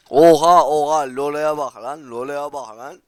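loud amazed yelling, drawn out; astonished shout. Real, not acted. Gamer yelling at a flying cheater, stunned and loud. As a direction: shout in disbelief at the screen.

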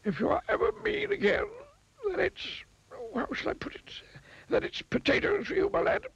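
high, warning wizard voice; stern wizard. A narrator's reading. Wizard threatening the miser with vegetables, stern. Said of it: "he added sternly".

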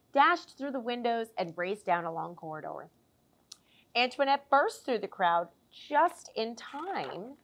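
lively picture-book storytelling voice; animated children's story narration; reading to children. A narrator's reading. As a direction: read it like story time for little ones. Reads picture book aloud.

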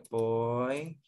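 spaced-out teaching take of poissonnier; syllable by syllable. Shown as a demonstration. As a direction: sound out poissonnier in separate syllables for the class. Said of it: "So, ito lang".

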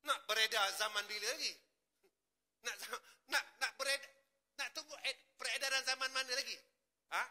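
high, loud, impassioned sermon; preacher crying out against a lax flock; preaching at full force. Acted. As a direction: scold the congregation with fervour.

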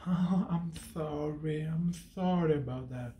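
hollow sorry-for-you tone, acted out; mock sympathy. Mocking how sympathy sounds to a friend, put-on pity. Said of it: "Because sympathy, it's".

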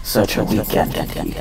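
sinister whisper. Acted. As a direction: sneer it softly at the weakling. Villain appears, mocking.

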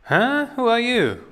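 high startled cry, voice jumps up. Acted. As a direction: jump at a stranger's sudden voice. Startled by future self appearing.

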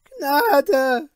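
high, loud rising cry for mercy; desperate plea. Acted. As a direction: wail it in terror. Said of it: "Sie stürzten nieder und riefen".

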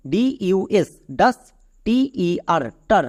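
ডাস and টার as two separate syllables; letter by letter, syllable by syllable; broken into syllables. Shown as a demonstration. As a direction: spell each half, then sound it: ডাস, টার. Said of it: "এদের মাঝখান থেকে দাও ভেঙে".

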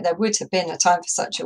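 fast iambic line; quick. Shown as a demonstration. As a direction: rattle it off quickly. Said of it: "It's really, really quick".

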